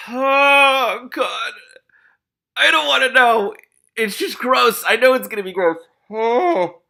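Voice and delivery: high, loud wail of dread; dismayed outcry. Real, not acted. Reader dreading what is in the other cellar, dismayed. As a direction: cry out in real squeamish protest.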